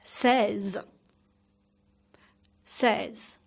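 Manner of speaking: "Seize" with stressed vowel and light z; slight final z. Shown as a demonstration. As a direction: lean on the start of "Seize", just touch the z. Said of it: "slightly say the final sounds z".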